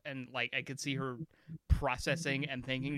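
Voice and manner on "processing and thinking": usual speaking voice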